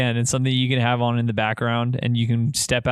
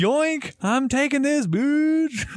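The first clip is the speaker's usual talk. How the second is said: much higher, cartoonish gloating voice; mock gloating; much slower than usual. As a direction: squeak it out like a cheeky thief. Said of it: "And you're like".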